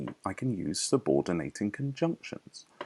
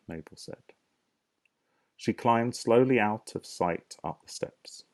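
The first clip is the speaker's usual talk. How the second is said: ordinary talk, nothing marked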